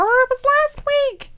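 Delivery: loud, drawn-out, high-pitched exasperation; exasperated protest. Real, not acted. Co-host protests being quizzed on last week, exasperated.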